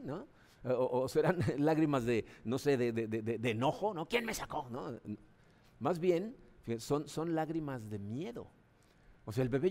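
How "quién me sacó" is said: high, put-on baby voice, mildly indignant; mock angry newborn. Preacher voicing a newborn annoyed at the doctor, high mock baby grumble. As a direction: play a newborn grumbling about being pulled out.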